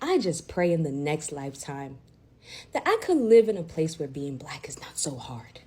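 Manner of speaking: holding back tears. Real, not acted. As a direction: barely hold it together. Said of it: "I'm trying so hard not to cry right now".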